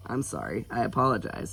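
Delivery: usual speaking voice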